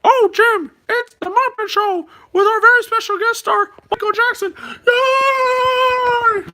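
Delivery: high excited puppet host; Muppet show host. Retold or imitated. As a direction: announce the star like a cheery Muppet. Muppet host announcing a guest.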